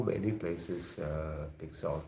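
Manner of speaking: ordinary talk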